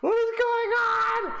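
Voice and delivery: very high, slow, incredulous squeak; squeaky disbelief. Real, not acted. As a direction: stretch it out in a high bewildered squeal. Baffled by Doomfist foot glitch.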